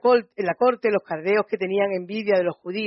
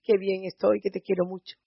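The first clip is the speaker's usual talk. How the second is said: much softer, gushing mock lover; mock sentimental sigh; 8 dB quieter than usual. Preacher mocking shallow love to listeners, soft gushing. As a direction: coo it like cheap romance. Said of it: "un sentimiento de".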